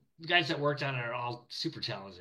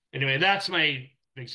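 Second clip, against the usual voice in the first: usual speaking voice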